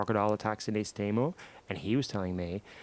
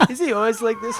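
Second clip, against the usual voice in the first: mocking pickup line; 10 semitones higher, 5 dB louder than usual. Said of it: "then he starts doing".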